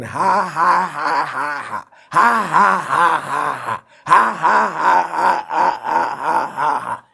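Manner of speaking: long, forced ha-ha-ha bursts; put-on laughter. Man laughing on at the devil, put-on laughter. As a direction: keep a deliberate fake laugh going. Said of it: "he was just putting it on".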